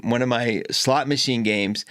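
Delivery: ordinary talk, nothing marked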